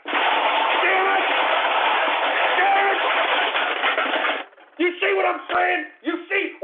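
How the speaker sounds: screamed damn it, then high angry yelling; furious shout. Real, not acted. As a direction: explode at the trolls, real rage. Host explodes after troll name.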